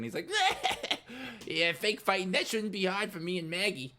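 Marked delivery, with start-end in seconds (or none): 0.3-1.4 high, short cackle; character laugh. Acted. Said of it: "laughs for a second". 1.5-3.9 high-pitched character voice; eager Scooter. Acted. Scooter agreeing to fake fight.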